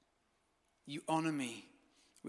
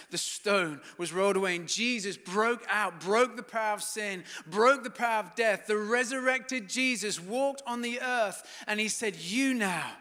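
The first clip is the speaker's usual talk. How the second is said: rising, ever louder proclamation; preaching at full force; 4 semitones higher, 8 dB louder than usual. Acted. Preacher urging the congregation toward God, ringing and loud. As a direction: build to a triumphant call.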